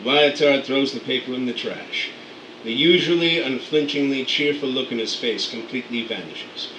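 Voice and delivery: ordinary talk, nothing marked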